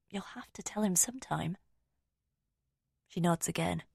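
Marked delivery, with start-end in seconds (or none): 0.1-1.6 soft unvoiced urging; whispered. A narrator's reading. Narrator gently urges Rosamond in a whisper. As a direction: gently whisper the hard truth.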